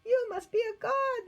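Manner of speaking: crying out in awe. Acted. Islanders amazed Paul survived, crying out in awe. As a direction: exclaim in astonishment. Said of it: "they cried".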